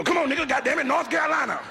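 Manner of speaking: high, loud hammed-up slaver shouting; mock slaver yell. Slave catcher shouting at the captured man, high and loud. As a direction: bark it out like a brutal slave catcher.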